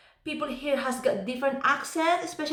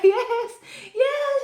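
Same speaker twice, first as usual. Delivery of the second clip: high-pitched fake agreeing; pretending to understand; 12 semitones higher, 3 dB louder than usual. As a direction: bluff with over-keen, high yeses. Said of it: "pretending like".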